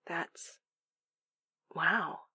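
soft, halting amazement; stunned. A narrator's reading. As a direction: break off, then breathe the words quietly. Stunned, lost for words.

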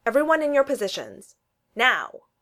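crisp captain's command; commanding order. A narrator's reading. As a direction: order the troops. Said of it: "Steve ordered".

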